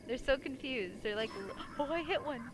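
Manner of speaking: normal talking voice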